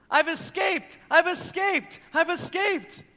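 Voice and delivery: put-on high, loud kid shouting I've escaped; child's gleeful yell. Dad imitating his son yelling across the playground, high gleeful shout. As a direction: shout it like a thrilled little boy on the run.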